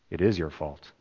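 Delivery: soft, low, stress on is; quiet verdict. Shown as a demonstration. Pastor softly telling the congregation the hard truth. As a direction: drop it low and hit is. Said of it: "we need to hear".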